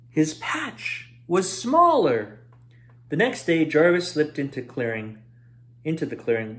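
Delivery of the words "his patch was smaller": slow, higher reveal leaning on smaller; dramatic reveal. A narrator's reading. Narrator revealing the patch shrank, slow and dramatic. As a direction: draw out the bad news slowly.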